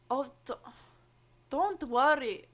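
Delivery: half-hearted go at a Russian accent; attempted Russian accent. Shown as a demonstration. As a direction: try a Russian accent, breaking off and restarting. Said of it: "I can't do a Russian accent".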